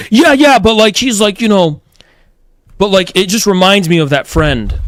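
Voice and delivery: lightly voiced flustered guy; mock defensive John. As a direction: play the friend squirming for excuses. Imitating John defending himself.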